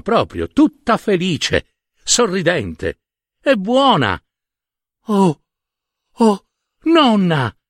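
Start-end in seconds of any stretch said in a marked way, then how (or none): 5.1-7.6 higher, slow, longing cry of oh nonna; crying out to grandma. Acted. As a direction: call out to her grandmother with a child's yearning. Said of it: "gridò la piccolina".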